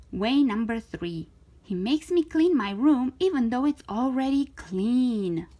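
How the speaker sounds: plain reading voice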